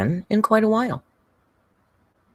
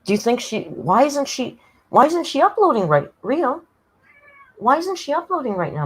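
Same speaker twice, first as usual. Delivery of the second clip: high pet-talk voice; 9 semitones higher than usual. Acted. Baby-talk question to the cat.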